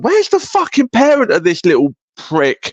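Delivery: high-pitched, retold indignation; exasperated high-pitched mock complaint; mock outrage. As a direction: act out a fed-up bystander, pitch shooting up. Acting out an outraged bystander.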